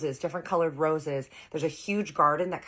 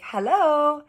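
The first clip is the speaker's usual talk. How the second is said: bright greeting; 12 dB louder than usual. Retold or imitated. Greeting family on stepping out.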